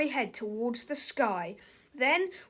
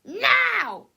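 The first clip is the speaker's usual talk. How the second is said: bossy yell; 3 semitones higher, 5 dB louder than usual. Acted. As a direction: demand your coffee. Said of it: "yelled Melvin".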